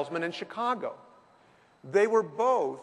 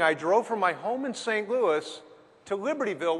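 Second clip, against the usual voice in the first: usual speaking voice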